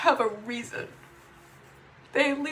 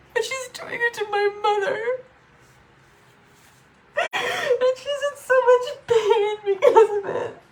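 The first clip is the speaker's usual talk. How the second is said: crying; 9 semitones higher than usual. Real, not acted. Daughter crying over her mother's pain, tearful. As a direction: voice breaking high through tears, half laughing.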